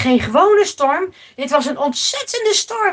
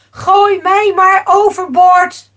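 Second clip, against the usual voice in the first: raised, forceful voice; loud resolute command; 5 semitones higher, 9 dB louder than usual. Acted. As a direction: order it loudly. Jona answers the alarmed sailors. (luid en vastberaden bevelen)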